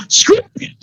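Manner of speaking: high, sudden snap of fury; angry outburst. Acted. Angry masked man dismissing the doctor's plea. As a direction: spit it out and give up on peace. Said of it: "He's, like, super angry".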